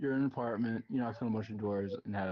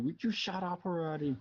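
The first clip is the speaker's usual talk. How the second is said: put-on high, irritated old woman voice; cranky old lady; 9 semitones higher than usual. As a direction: snap it out like a grumpy old neighbour. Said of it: "sticks her head out and says".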